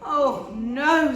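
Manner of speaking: loud, exasperated groan; a tired bear at the end of his rope; fed-up Mister Bear. A narrator's reading. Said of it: "said Mister Bear".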